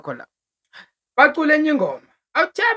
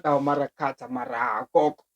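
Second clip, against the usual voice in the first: mock protest; 7 semitones lower, 6 dB quieter than usual.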